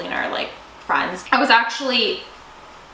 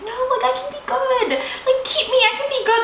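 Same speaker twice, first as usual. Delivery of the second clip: put-on pleading child; 13 semitones higher than usual. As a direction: beg like a small child who wants to stay. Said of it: "being like".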